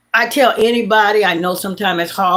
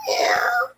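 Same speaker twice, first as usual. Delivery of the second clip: high thin crying meow; kitten meow; 4 dB quieter than usual. Shown as a demonstration. Imitating the weak kitten's high crying meow. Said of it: "Her meows went from".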